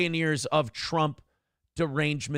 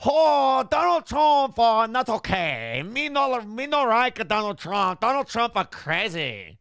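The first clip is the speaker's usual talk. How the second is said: made-up character voice; 6 semitones higher than usual. Retold or imitated. Host does another silly voice, high and hammed-up. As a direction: ham up a broken-English character. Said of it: "allowed to do voices now".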